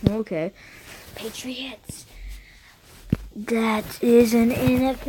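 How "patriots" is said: whispered name. Acted. Whispering the name Patriots aside.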